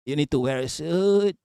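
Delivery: half-hearted mimicry with stretched, wobbling word endings; bad advisor impression. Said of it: "that's a bad impression".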